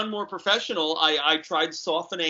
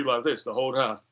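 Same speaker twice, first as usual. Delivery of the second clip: lower, light [name] voice; [name] impression; 5 semitones lower, 5 dB quieter than usual. Shown as a demonstration. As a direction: slip into a lazy [name] croon, lightly. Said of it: "if I just spoke like [name]".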